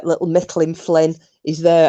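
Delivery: ordinary delivery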